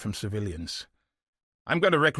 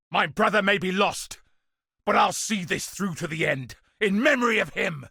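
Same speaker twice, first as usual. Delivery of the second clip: resolute vow; 9 semitones higher, 4 dB louder than usual. Acted. Vowing to finish for lost brother.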